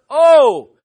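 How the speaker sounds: loud, slow proclamation, phrase by phrase; big drawn-out OH, then measured, forceful phrases; leading a recitation. Shown as a demonstration. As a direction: lead the congregation in a booming chant. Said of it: "let's say all three together".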